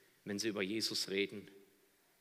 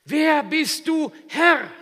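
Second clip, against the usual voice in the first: loud, much higher, slow shout; awed shout; 21 dB louder, much slower than usual. Acted. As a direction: cry out in humbled awe. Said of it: "Er fragt nicht". (ehrfürchtiger Aufschrei)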